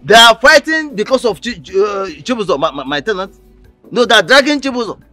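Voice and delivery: shocked outburst. Acted. Shocked landlady hears daughters' fight.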